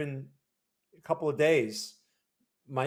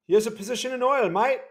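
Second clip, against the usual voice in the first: quick, higher mock Australian accent; 7 semitones higher, 5 dB louder than usual. Host slips into Australian accent for a viewer, mock Australian. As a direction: throw in a cheeky Australian accent. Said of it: "Oh, that's Australian".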